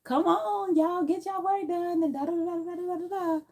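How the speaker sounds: very high sing-song cheery greeting; high-pitched forced cheer; fake happy person. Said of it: "you have to be this happy person".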